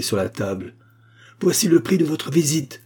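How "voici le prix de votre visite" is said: high, emphatic and haughty; insulting dismissal. A narrator's reading. Abogin paying off the doctor, angrily insulting. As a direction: fling the words at him.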